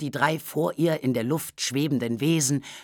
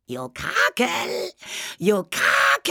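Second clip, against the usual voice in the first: high, drawn-out creature shriek; calling spook cry; 8 semitones higher, slower than usual. A narrator's reading. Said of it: "rief das Knäuel". (hoher, lang gezogener Spukruf)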